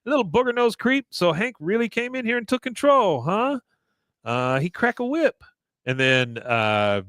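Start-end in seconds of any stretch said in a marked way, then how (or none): 0.1-5.4 high-pitched impression of Min; character voice. Min responds to Connie about Hank, high character voice. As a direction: play a woman reacting, voice pitched way up.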